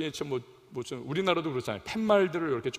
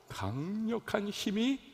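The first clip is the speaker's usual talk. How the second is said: ordinary talk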